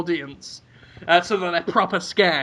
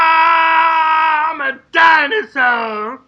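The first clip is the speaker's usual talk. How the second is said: high, loud, slow "I'm a dinosaur"; dinosaur shout; 8 semitones higher, 4 dB louder, much slower than usual. Pretend. Performer roaring at kids as a dinosaur, loud high shout. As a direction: bellow it at the kids. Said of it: "look up and go".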